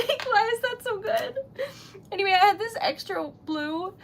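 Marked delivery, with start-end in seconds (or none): 0.1-1.4 high, laughing, then near tears; overwhelmed delight spilling out; laughing through tears. Real, not acted. Marvelling at her own sticker, laughing then tearful.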